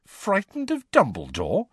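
high, disbelieving question; can't believe what he just heard; incredulous. A narrator's reading. Harry questioning Tonks in disbelief, pitched high. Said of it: "said Harry incredulously".

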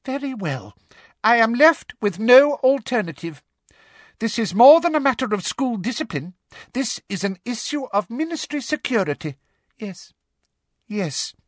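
high, hushed resolve; Umbridge, resolving. Acted. Umbridge talks herself into cursing.

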